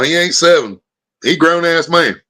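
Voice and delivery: usual speaking voice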